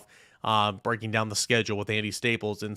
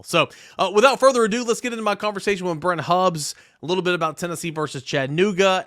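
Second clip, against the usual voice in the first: higher and louder, a big announcer lift into the interview; hyped host intro; 6 semitones higher, 5 dB louder than usual. Real, not acted. Podcast host introducing his guest, higher and louder with hype. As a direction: sell the handoff like a showman.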